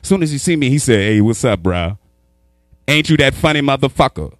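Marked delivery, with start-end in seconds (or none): none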